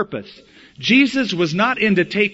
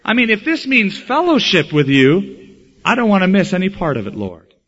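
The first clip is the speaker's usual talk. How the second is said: eager plea; 3 semitones higher, 7 dB louder than usual. Acted.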